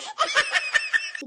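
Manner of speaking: high sneering cackle; mocking laugh. Mother-in-law cackles at the pizza-eating daughter-in-law. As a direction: cackle in triumph.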